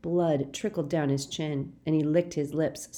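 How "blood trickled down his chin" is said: usual speaking voice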